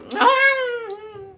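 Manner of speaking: a sudden high-pitched laughing squeal; high giggle breaking out. Real, not acted. Laughs at own crying habit.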